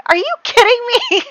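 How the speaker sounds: high, disbelieving cry of Are you kidding me; shocked disbelief. Retold or imitated. Player reacting as her sim dies: shocked high cry. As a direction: squeal it out in shock at the game. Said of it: "Because I'm like".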